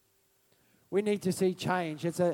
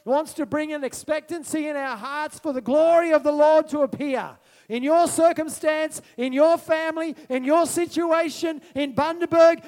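raised, emphatic sermon voice; impassioned preaching; 6 semitones higher, 6 dB louder than usual. Real, not acted. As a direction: proclaim it with building force. Preaches expectancy to congregation.

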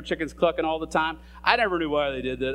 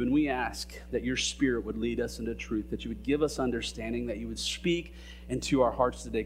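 softer, lower prayer voice; 4 semitones lower, 4 dB quieter than usual. Real, not acted. Pastor praying over the congregation, soft earnest prayer. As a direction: pray earnestly and quietly before the reading.

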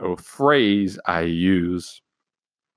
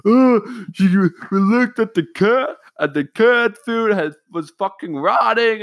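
higher, louder whiny mock complaint; mock complaining family; 13 semitones higher, 5 dB louder than usual. Family home from trip griping about the cat food, mock whiny. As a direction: whine like nagging parents. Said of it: "complaining about shit like".

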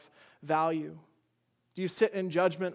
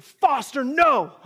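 put-on loud, high warning shout to a child; alarmed yell; 7 semitones higher, 10 dB louder, slower than usual. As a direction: shout it sharply to stop a child running into the street. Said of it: "He thought I was yelling at him".

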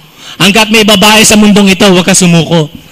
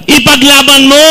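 rallying cry; 4 semitones higher than usual. Shown as a demonstration. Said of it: "Sabihin mo sa katabi mo".

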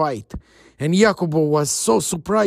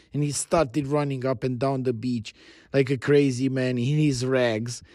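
plain reading voice

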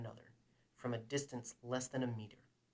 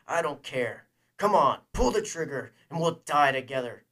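furious dare; 2 semitones higher, 13 dB louder than usual. Acted. Man daring his enemy to shoot, shouting furiously. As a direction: goad him in mounting, shouted fury. Acted armed standoff, daring Fett.

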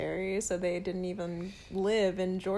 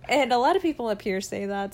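ordinary delivery

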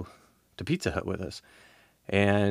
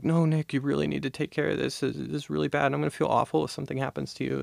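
higher, softer, hurried pleading; imitated motherly girlfriend; 6 semitones higher, 5 dB quieter, faster than usual. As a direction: fuss over him like a mother. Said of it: "kinda doing the motherly thing".

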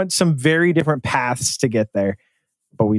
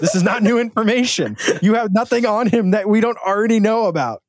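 pitched up, laughing retort; mock scolding with a laugh in the voice; 7 semitones higher than usual. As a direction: talk back at him, higher and amused.

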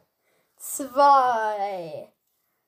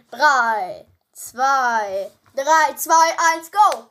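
building countdown, louder at the end; 8 dB louder, much slower than usual. Real, not acted. Countdown before the dice roll.

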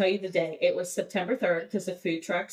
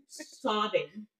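normal talking voice

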